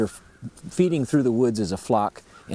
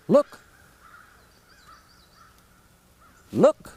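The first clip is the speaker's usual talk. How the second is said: high, loud barked "Look!" like a lost bird calling out; louder turkey cluck; 3 dB louder than usual. Shown as a demonstration. To find other birds, a louder cluck. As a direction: call "Look!" out louder, searching for the flock. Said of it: "use that cluck a little bit louder".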